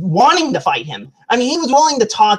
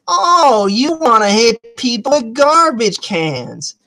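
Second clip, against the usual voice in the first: menacing taunt; slower than usual. Retold or imitated. Woman gets up after the trash can, menacing taunt. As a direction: draw it out as a slow taunt. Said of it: "she's like".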